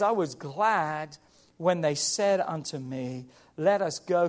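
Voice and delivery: slow, low, measured psalm reading; soft, deliberate Bible verse; scripture reading. Acted. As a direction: recite it low with space between phrases. Reads psalm to congregation.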